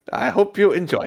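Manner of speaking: high French cook voice; hammed-up French waiter; French accent. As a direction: offer the dish in put-on French. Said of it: "he is French".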